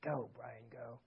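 very soft, low imagined coach; coach's quiet urging. Retold or imitated. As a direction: urge him on almost under your breath. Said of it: "you know what he'd say?".

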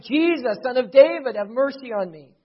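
loud crying out for mercy; shouted plea. Retold or imitated. Blind man calling to Jesus as he passes, shouting. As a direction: call out over a crowd, imploring. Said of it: "He kept shouting".

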